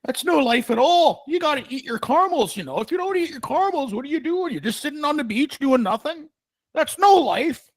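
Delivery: high, pompous mock lecture on caramels; high-pitched lofty sermon on living; mock lecturing voice. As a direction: preach it way up high like a pretentious philosopher. Mock philosopher rant voice.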